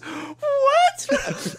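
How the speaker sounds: mock outraged squeal, very high; a squeaky disbelieving "What?"; shocked shriek. Boyfriend imitating girlfriend's shocked reply, high squeal. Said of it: "She goes".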